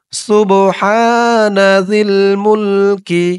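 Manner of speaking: slow chanted সুবহানা জিল মুলকি; slow recitation. Shown as a demonstration. Teacher models the phrase for viewers to repeat, chanted. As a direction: recite it long and even for repeating. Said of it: "আমার সাথে বলুন".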